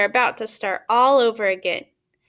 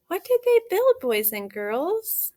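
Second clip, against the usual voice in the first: much higher, eager question to the class; excited question; 6 semitones higher than usual. Real, not acted. Teacher asks children excitedly.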